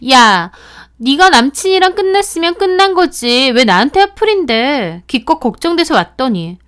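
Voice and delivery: high, loud indignant yell; angry outburst. Acted. Friend lashing out at the narrator, angry yell. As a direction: turn the anger back on her. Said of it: "본인이 더 화를 내더라고요".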